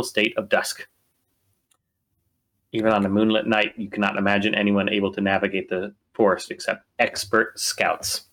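storyteller's scene-setting read; game master scene setting. A narrator's reading. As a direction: paint the dark forest for the players. GM sets the forest scene.

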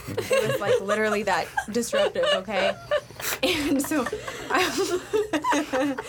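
laughing through the words, voice jumping high; can barely get it out for laughing. Real, not acted. Laughing while telling story.

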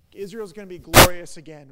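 loud, ringing proclamation of glory; triumphant proclaiming. Retold or imitated. Acting out hopeful disciples, loud triumphant declaration. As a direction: belt it out like a victory cry. Disciples' hopes voiced as quote.

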